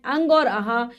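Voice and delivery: normal talking voice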